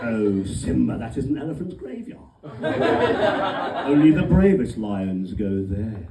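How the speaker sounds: put-on low, villainous English accent; deep, loud English cartoon villain; Scar. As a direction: play an evil lion with an English accent. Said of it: "English accent".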